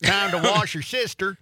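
put-on high-pitched Southern accent call; mock Southern parent. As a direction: holler like a Southern parent, joking. Joke Southern parent calling for bath.

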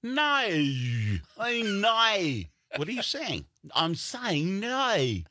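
high, slow mock Australian "no"; Australian accent. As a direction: stretch the Australian "no" out, highly pitched. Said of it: "they go".

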